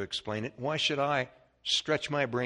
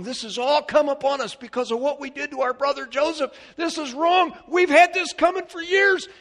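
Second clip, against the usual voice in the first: high, loud preaching that climbs to a shout; full-force preaching; 12 semitones higher, 7 dB louder than usual. Acted. Preacher pounding out the mystery of Christ to the congregation, full-force high shout. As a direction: preach it out with everything you have.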